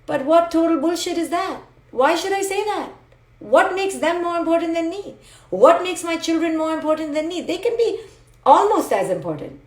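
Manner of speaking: high-pitched, incredulous rhetorical questions; indignant protest. Acted. Indignant challenge to self-effacing beliefs.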